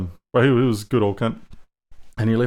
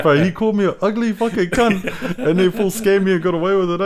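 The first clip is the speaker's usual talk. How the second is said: higher-pitched mock grievance, laugh creeping in; retold indignant complaint; 8 semitones higher than usual. Scammed mate complaining to his friend, laughingly indignant. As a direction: imitate a duped mate protesting.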